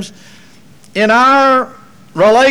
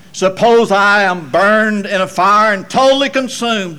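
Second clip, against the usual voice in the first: ordinary delivery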